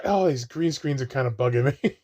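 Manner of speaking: ordinary delivery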